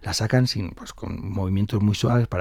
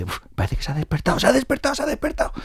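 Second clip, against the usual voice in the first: high, hushed excitement climbing with each repeat; breathless-sounding rising alert, kept soft; excited maid; 12 semitones higher, 3 dB quieter, faster than usual. Acted. A maid spots the old woman waking, hushed excitement.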